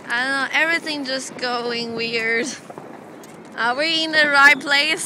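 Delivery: ordinary talk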